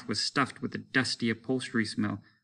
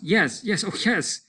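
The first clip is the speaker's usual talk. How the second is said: frantic tearful agreement; 9 semitones higher than usual. A narrator's reading. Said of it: "a frantic, watery-eyed nodding".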